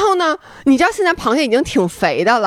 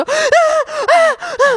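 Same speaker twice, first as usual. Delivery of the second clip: long, high frightened wails; much slower than usual. Retold or imitated. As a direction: wail in panic into the phone. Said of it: "她一开始接我就". (对着视频电话高声哀嚎)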